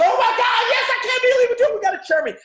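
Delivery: high, loud, elated yelling from the crowd; ecstatic cheering. Pretend. Team at the front yelling to their new chairman, ecstatic cheering. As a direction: scream it like fans who just saw a win.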